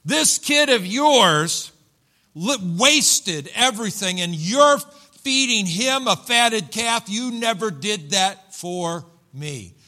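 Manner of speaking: slow, loud, high-pitched indignation; contemptuous older brother. Acted. Older brother objects to the fatted calf, bitterly indignant. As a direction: hammer out the accusation word by word. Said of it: "that's the way he said it".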